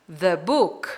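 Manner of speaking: "the book" as "thuh book"; short uh in "the" before "book". Shown as a demonstration. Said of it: "tem som de consoante".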